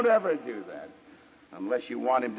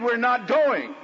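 high, emphatic henpecked husband; hammed-up domestic defiance; mock firm; 10 semitones higher, 4 dB louder, slower than usual. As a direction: put your foot down, theatrically. Henpecked man lays down law.

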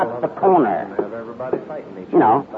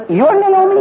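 astonished; 3 dB louder, slower than usual. Acted. Neighbors' astonished question.

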